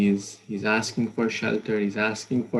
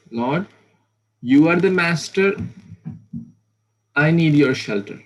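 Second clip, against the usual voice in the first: loud, higher pleading to a master; raised, earnest servant's plea; humble plea; 5 semitones higher, 7 dB louder than usual. Acted. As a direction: cry out to the Lord for shelter. Servant devotee begging the Lord.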